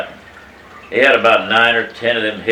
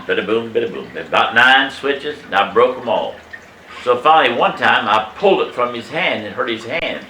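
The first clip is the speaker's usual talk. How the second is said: ordinary talk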